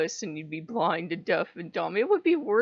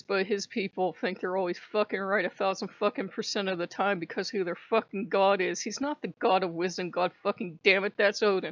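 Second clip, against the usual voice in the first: normal talking voice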